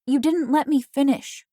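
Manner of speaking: high, loud indignant whine; complaining. A narrator's reading. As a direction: protest it, frustrated. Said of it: "I complained".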